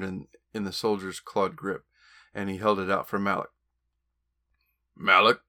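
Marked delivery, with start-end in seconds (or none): 5.0-5.4 loud growled name. Acted. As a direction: bark the name out. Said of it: "Xander growled".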